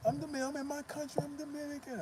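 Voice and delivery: imitating a frantic woman. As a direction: squeal it out like a woman losing it. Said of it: "she's going crazy".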